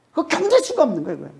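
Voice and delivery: usual speaking voice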